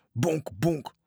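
high, punchy spoken thuds; thudding impact noise. A narrator's reading. Rafts crash into the mother ship, imitated thuds. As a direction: voice two collisions as separate bumps.

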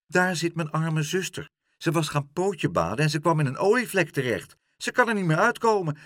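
high, urgent gull voice; agitated pleading. A narrator's reading. Gull pleads for his sister's rescue. (opgewonden smeken, hoge stem)

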